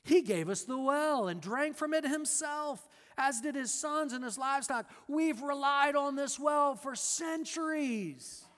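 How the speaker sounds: put-on proud and stubborn, holding to the old well; defiant woman. The woman defends Jacob's well to Jesus, indignant.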